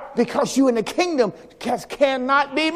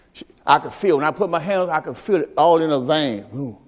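ordinary talk

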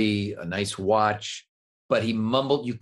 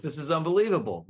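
normal talking voice

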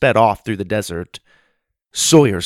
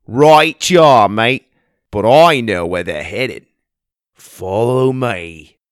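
loud, hearty Australian drawl; Australian accent; 7 dB louder, slower than usual. Acted. Aussie bushman greeting the kids in the pond, hearty Australian accent. Gundy speaks.

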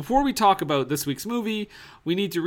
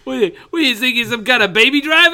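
loud mock taunt; mock rant; 7 semitones higher, 8 dB louder than usual. Co-host taunting a director over Baby Driver, loud mock jeer.

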